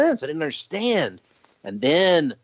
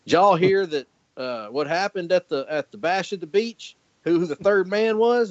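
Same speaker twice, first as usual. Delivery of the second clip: put-on thick Southern accent; 6 semitones higher than usual. Uncle breaking wrestling news to the kid, in a thick Southern accent. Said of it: "he's got a real thick Southern accent".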